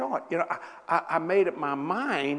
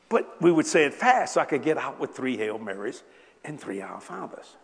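ordinary talk

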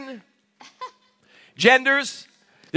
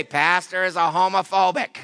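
lightly voiced accuser, pitched lower; mock accuser; 4 semitones lower than usual. Congregant quoting the pastor as a homophobe, mock accusation. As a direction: drop into a low mimicking voice. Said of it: "So you can't walk out here and say".

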